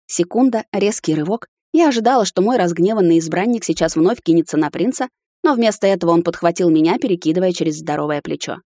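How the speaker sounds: plain reading voice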